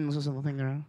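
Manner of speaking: normal talking voice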